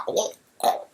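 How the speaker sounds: breathy mock gagging sounds; fake retching. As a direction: pretend to gag. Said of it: "pretending she's gonna throw up and go".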